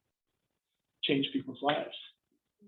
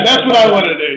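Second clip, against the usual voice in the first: laughing; 5 semitones higher, 15 dB louder than usual. Real, not acted. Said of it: "laughing admission".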